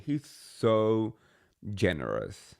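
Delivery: sarcastic. Real, not acted. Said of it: "I'm being sarcastic".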